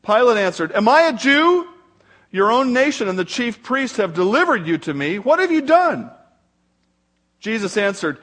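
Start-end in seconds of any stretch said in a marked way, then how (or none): 0.7-6.1 raised, pressing questions, read aloud with force; indignant questioning. A narrator's reading. Pilate answers Jesus at the trial, indignant questioning. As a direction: challenge the prisoner, pitch jumping on the questions.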